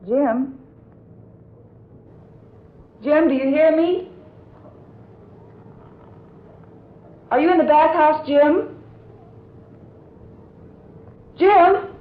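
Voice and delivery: anxious calls for a missing husband; calling out. Acted. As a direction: shout his name into the distance.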